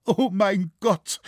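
acted anguished cry, high and drawn out; sobbing cry. A narrator's reading. A desolate girl calls to God, sobbing cry. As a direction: cry out slowly in high-pitched despair. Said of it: "rief sie unter Schluchzen".

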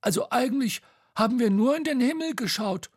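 high, quick, halting explanation; back-pedal in a high voice, seeking support; high-pitched nervous character; flustered excuse. A narrator's reading.

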